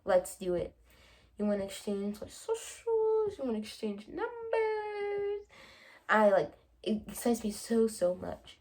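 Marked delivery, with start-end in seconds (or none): 2.2-5.4 high, slow squeal of excitement; a high, drawn-out excited squeal; giddy excitement. Real, not acted. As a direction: gush like a lovestruck fan. Excited about exchanging numbers.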